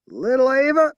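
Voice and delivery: high, loud country yell. A narrator's reading. Blind woman yelling for her girl, loud twang. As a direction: holler it across the yard. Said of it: "she yelled".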